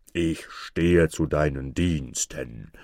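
low, slow giant voice; booming giant. A narrator's reading. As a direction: boom it out low and drawn-out. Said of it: "dröhnte er der Mutter ins Ohr". (tiefe, langsam dröhnende Riesenstimme)

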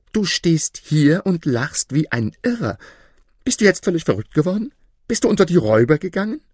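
high, rapid-fire appalled questions; aghast wife. A narrator's reading. Wife scolds her laughing husband, appalled and fast. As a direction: fire off horrified accusations. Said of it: "Seine Frau war entsetzt".

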